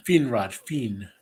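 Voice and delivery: Finrod with a long ee, Feenrod; Feen vowel. Retold or imitated. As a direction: stretch the Fin into Feen. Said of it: "like it was spelled F E E N".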